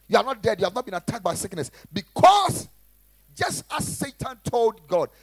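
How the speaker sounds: loud, driving sermon delivery; preaching. Acted. Pastor preaching loudly to his church. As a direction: preach it hard to the congregation.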